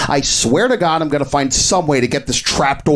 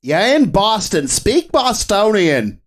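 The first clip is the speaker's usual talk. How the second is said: put-on thick Boston accent, pushed higher; 4 semitones higher than usual. Host explaining the category in a heavy Boston accent. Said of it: "which I am now going to stop doing".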